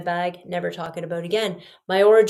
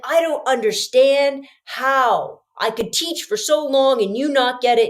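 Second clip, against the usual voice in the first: exasperated rant, pitched up; retold mad TA; 5 semitones higher, 3 dB louder than usual. TA handing back papers to the class, mock-angry exasperation. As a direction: imitate a fed-up teacher scolding, pitch raised. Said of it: "He's so mad. He's like".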